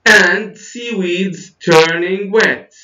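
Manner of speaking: very slow and high, "And" launched strongly, "wet" falling; exaggerated teacher model, stretching each word down to "wet"; high, drawn-out demo ending in a fall on "wet"; list intonation. Shown as a demonstration. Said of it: "notice the intonation".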